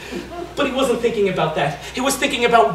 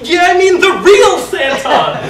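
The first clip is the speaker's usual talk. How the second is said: high, loud and slowed, bursting with glee; thrilled shout; 8 semitones higher, 7 dB louder than usual. Acted. Tony insisting to Jesus it's the real Santa, thrilled shout. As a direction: drag it out in sheer excitement.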